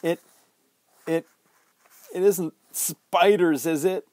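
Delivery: put-on a frightened boy stumbling over his words; panicky stammer. A narrator's reading. Boy in a nightmare begging the bully, panicky stammer. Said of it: "Nick felt himself beginning to panic".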